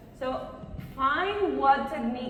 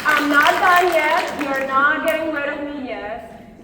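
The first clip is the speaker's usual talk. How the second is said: loud, raised voice over the crowd; calling out; 4 semitones higher, 10 dB louder than usual. Real, not acted. Speaker telling applauding audience she isn't finished, calling out. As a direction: call out to stop the applause early.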